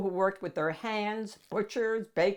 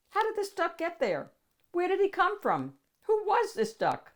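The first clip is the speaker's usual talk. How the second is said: curious, much higher questions; excited storyteller wondering aloud; 11 semitones higher than usual. A narrator's reading. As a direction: ask in rising wonder, up high. Wondering questions about the duck.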